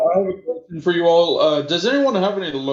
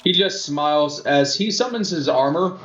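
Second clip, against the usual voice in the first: ordinary delivery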